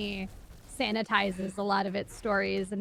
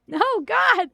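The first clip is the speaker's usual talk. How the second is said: pained cry; 11 semitones higher, 8 dB louder than usual. Real, not acted. Remembering a traumatic scene.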